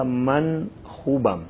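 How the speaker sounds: من خوبم very slowly, من stressed; slow Persian model. Shown as a demonstration. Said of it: "تلفظ پہ غور کریں". (من خوبم کو بہت آہستہ)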